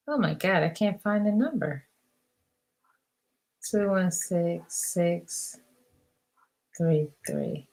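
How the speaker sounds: low-volume talking to oneself; distracted muttering. Real, not acted. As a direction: mumble softly while hunting for a number. Muttering while searching for number.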